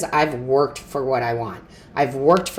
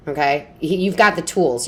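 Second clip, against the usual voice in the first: ordinary talk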